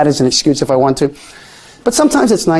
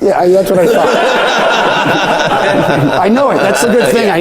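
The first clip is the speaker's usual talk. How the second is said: laughing; 6 semitones higher than usual. Real, not acted. Laughs at his own bad accent.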